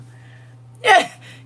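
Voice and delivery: high, loud burst of laughter; an emotional cackle; loud high-pitched laugh. Real, not acted.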